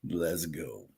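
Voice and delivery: quiet, low "Let's go"; low murmur. Shown as a demonstration. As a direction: drop it down, soft and low. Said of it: "you don't do it in the same voice".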